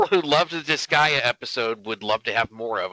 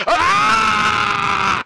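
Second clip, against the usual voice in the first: high loud shriek of fright; terrified scream; 8 dB louder than usual. Acted. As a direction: scream as the giant looms. Giant appears.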